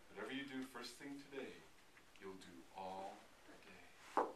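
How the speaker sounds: low, soft and knowing; half sly, half let down, voice dropped; sly, disappointed. A narrator's reading. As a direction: quietly pronounce it with a hint of slyness. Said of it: "half sly and half disappointed".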